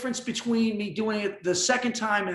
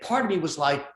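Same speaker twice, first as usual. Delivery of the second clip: ordinary talk, nothing marked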